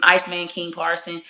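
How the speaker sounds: ordinary talk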